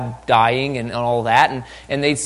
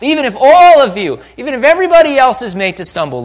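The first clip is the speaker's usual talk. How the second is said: high, very loud overblown boast; brash Peter; 10 semitones higher, 10 dB louder than usual. Acted. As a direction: blurt out a hammed-up vow of loyalty. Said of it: "picks up foot".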